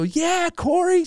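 very high, drawn-out cheer; put-on excitement. Comedian answers booker's call, high put-on excitement. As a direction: play along, overdoing the joy. Feigned joy on the phone.

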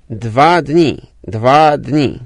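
lightly voiced sailor, struggling for words; halting foreign sailor. A narrator's reading. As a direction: repeat it slowly, searching for Polish. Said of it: "powtarzał z trudnością marynarz".

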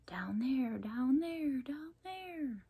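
whispered echo fading away; distant whispered echo up the stairwell. A narrator's reading. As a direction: echo back from far below, ever fainter. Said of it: "came echoing back".

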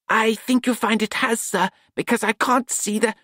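eager to show his fix; young apprentice Nut. A narrator's reading.

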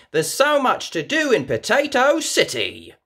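chirpy ticket seller's welcome; Miss Rabbit voice. A narrator's reading. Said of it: "she says".

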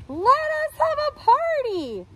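high party voice; salad greens. A narrator's reading. Salad greens calling for a party, high and eager. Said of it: "said the salad greens".

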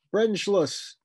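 high, loud call-out; shout. A narrator's reading. Woman calling engine cutoff to the ship, shouting. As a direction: shout the callout, pitch jumping up. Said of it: "He heard Hazel shout".

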